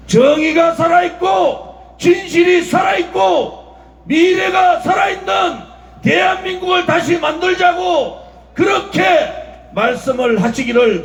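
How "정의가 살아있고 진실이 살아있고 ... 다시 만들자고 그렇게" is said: fiery rally speech. Real, not acted. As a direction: rouse the crowd at full voice.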